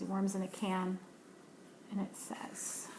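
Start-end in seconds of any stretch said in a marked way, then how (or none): none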